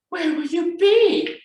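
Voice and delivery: high, drawn-out cub's cry; alarmed Baby Bear. A narrator's reading. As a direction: cry out the question like a small cub. Baby Bear worried by mother's words.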